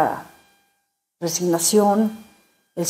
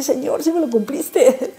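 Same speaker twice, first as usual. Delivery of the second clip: laughing; 8 semitones higher, slower than usual. Retold or imitated. Wry prayer granted, laughing.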